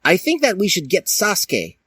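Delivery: high squeaky voice; Link's voice. Acted. Link suggesting Sasuke to the gang, in a high squeaky voice. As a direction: pipe it up like a kid. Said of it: "said Link".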